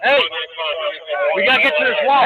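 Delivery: usual speaking voice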